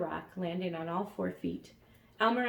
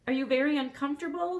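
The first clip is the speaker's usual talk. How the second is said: high, loud and fast; screaming over noise; 8 semitones higher, 6 dB louder, faster than usual. A narrator's reading. Elmer screams to the dragon above the storm noise. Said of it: "he screamed above the noise".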